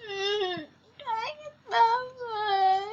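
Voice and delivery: high, slow, tearful whine; mock whimper. Player about to die in the game, mock whimpering.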